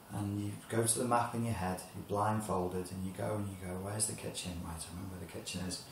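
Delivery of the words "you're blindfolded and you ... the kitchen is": hushed, fading inner muttering; muttering under the breath; quiet muttering to self. Acted. Blindfolded person muttering to self.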